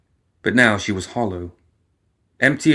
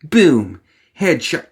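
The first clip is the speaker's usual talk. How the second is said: louder gloating shout, high on headshot; triumphant boast; 5 semitones higher, 4 dB louder, slower than usual. Acted. Alice gloating at Leviticus after taking his piece. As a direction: crow your victory. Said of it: "She couldn't help from saying it".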